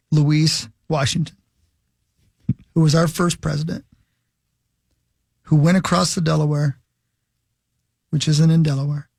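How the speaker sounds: tongue-in-cheek ceremonial address; mock solemn tribute. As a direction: deliver a grave patriotic salute, phrase by phrase. Mock patriotic tribute speech.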